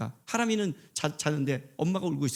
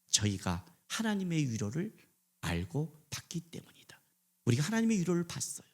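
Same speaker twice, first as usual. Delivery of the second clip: softer whispered aside; reverent whisper; 7 dB quieter than usual. Acted. Preacher drops to a whisper.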